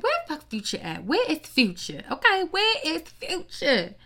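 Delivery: high, loud demand for Future; indignant yell. Retold or imitated. Mock shouting for Future to show up, indignant and loud. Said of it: "And I'm like".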